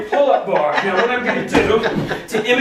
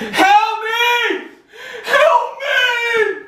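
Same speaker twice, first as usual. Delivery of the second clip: high, crying scream of help me; desperate cry for help; much slower than usual. Retold or imitated. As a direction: shout for help as if for your life. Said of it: "I start screaming for my life".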